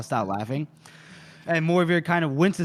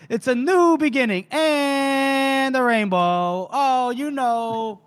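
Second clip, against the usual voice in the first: dreamy carefree Vittori; 9 semitones higher, 3 dB louder, slower than usual. Retold or imitated.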